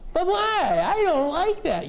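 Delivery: put-on high, whining kid; whiny child voice. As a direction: whine like a sulky child. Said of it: "kind of the whiny voice".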